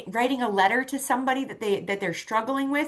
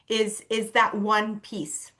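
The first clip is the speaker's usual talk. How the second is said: normal talking voice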